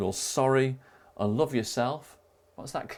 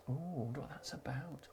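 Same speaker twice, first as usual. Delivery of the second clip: hushed, much softer mock-curious whisper; teasing whisper; 3 semitones higher, 14 dB quieter than usual. Quiz host teasing the next round, mock-curious whisper. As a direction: whisper it as if intrigued.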